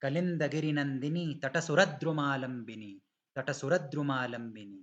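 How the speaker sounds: slow teacher model of "तटसुरद्रुमालंबिनी"; model recitation. Shown as a demonstration. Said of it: "softly आएगा तटसुरत".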